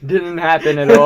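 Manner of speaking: laughing through the words; laughing denial. Real, not acted. Laughing off Josh's Mandarin joke, real laughter. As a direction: deny it while cracking up.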